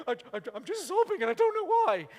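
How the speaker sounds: mock tearful confession; mock sobbing bloke. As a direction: imitate a big bloke sobbing in worship. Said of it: "big burly blokes, when they're going".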